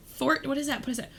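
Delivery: usual speaking voice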